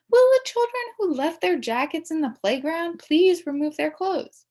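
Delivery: high, loud intercom call to the custodian; loudspeaker announcement. A narrator's reading. Secretary paging the custodian over the loudspeaker, high and loud. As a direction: page the janitor over the PA. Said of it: "makes announcements over the loudspeaker".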